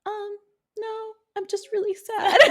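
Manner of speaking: high, soft mopey voice; mock pitiful caller. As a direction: play it heartbroken and meek. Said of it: "I can imagine the call".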